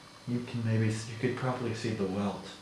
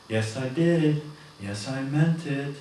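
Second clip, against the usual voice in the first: slightly higher, defensive coworker; lightly voiced coworker; 4 semitones higher, 3 dB louder, slower than usual. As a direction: protest a little, lightly voiced.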